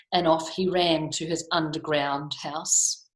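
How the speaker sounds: ordinary delivery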